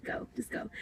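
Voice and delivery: barely-there whisper; whispered dismissal. Retold or imitated. Silently dismissing him from the house, whispered. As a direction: whisper it, all breath and no voice.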